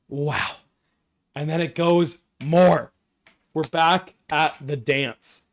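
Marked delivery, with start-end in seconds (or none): none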